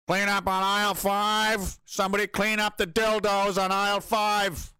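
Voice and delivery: mock supermarket tannoy call; store PA announcer. Grocery store announcer bit.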